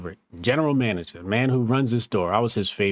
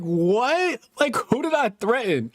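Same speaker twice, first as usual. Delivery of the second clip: high, disbelieving retort; incredulous; 11 semitones higher, slower than usual. Retold or imitated. Fired worker to regional manager, high disbelieving what. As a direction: act floored by the accusation.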